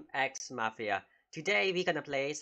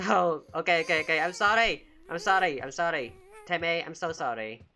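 panicked apology; 3 semitones higher, 6 dB louder than usual. Real, not acted. Player apologizing to the upset baby, panicked. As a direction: blurt out repeated sorries, raised and urgent.

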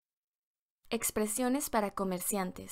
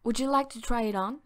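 quick, run-together first read; fast casual question to a customer; brisk model take; faster than usual. Shown as a demonstration. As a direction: rattle it off like a busy clerk. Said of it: "Se dice".

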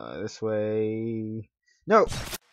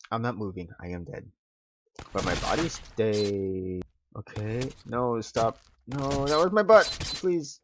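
ordinary talk

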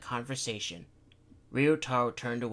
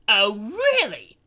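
high, loud, slow mock disbelief; sarcastic; 9 semitones higher, 13 dB louder, slower than usual. Takashi sarcastically challenging Daichi.

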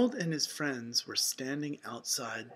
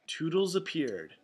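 usual speaking voice

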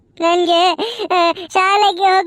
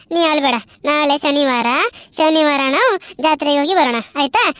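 consoling grandma; 5 semitones lower than usual. Acted. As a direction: hush the boy gently in a lower voice. Grandma consoling the crying boy.

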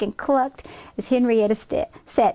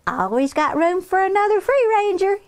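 put-on high, bright-spirited girl's welcome; cheerful child; 10 semitones higher, faster than usual. Henrietta welcoming Claire, cheerful child. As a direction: echo the farmer in a high child's voice.

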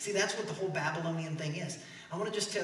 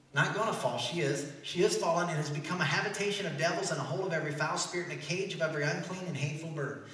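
usual speaking voice

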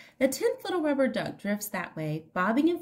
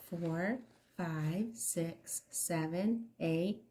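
plain reading voice

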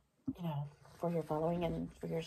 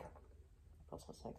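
slow, sick whisper; 21 dB quieter, much slower than usual. Real, not acted. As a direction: barely whisper it, feeling ill. Nauseous, whispering miserably.

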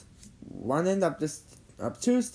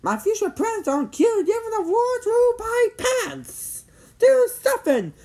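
high, loud, frantic demand; demanding girl; 6 dB louder than usual. A narrator's reading. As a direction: demand action in a piercingly high voice. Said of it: "she demanded".

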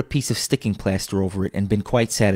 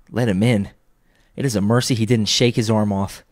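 ordinary delivery

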